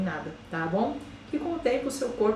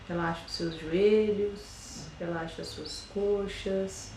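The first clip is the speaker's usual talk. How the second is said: slow, soft meditation voice with long pauses; guided meditation; 5 dB quieter than usual. Acted. Yoga teacher guiding listeners through a body scan, slow and soft meditation voice. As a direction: ease them into calm, one body part at a time.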